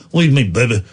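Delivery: low mock tough guy; a hint of a gruff action star; light imitation of a movie tough guy; Vin Diesel impression. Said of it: "You know the guy I'm doing?".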